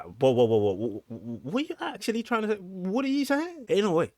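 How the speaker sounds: mock a baffled viewer reacting in disbelief; shocked onlooker. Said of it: "and be like".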